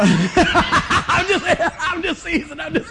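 laughing through a playful tease; giggling light teasing; laughing while teasing. Real, not acted. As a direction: chuckle out the words, real amusement. Jokes that a heckler must leave.

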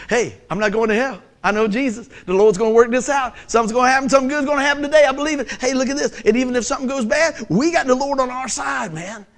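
cranked-up joyful believer. Acted. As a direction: proclaim it with Friday-level excitement. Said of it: "What would happen if you lived like that every day?".

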